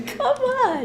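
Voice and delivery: high-pitched, playful plea; mock exasperation. Student to her guide after a stock answer, mock exasperated. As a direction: whine it in joking protest.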